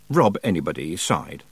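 ordinary delivery

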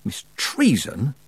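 severe, warning address. Acted. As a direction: draw the name out as a reprimand. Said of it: "said Tiffany severely".

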